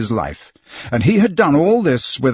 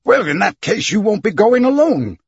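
higher, stirred-up outburst; moved exclamation; 9 semitones higher than usual. A narrator's reading. Captain giving in to Fogg, moved outburst. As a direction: won over despite himself. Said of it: "exclaimed the captain".

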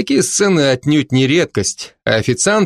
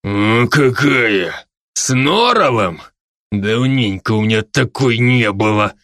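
put-on growling drunk; 2 semitones lower than usual. A narrator's reading. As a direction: growl it into her ear, drunkenly. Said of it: "рычал он ей на ухо". (пьяное похотливое рычание)

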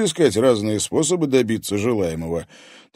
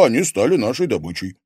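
ordinary delivery, nothing marked

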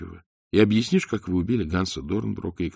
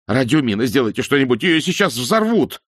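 loud, high, frantic pleading; panicked shout; 6 semitones higher, 7 dB louder than usual. A narrator's reading. Terrified man begging the crew to do something. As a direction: beg in terror, voice climbing. Passenger panics over bomb.

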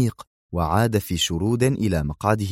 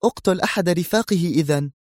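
high female voice, brisk; quick suggestion; 9 semitones higher than usual. Acted. Zosha quickly proposing a killing, high voice. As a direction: blurt it out fast. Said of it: "قالت في سرعة".

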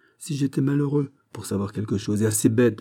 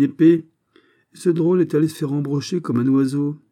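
high, loud sarcastic tirade; exasperated mockery; 5 semitones higher, 4 dB louder than usual. Captain mocking the youth's deadly plan, exasperated. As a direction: rail at his foolishness.

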